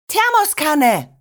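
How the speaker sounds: high, stretched-out call; urgent call. A narrator's reading. Grandmother rushes through the house, calling out urgently. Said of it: "rief Oma".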